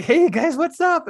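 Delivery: high, loud, upbeat hello; retold greeting. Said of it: "And I'm like".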